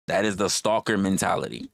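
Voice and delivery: ordinary delivery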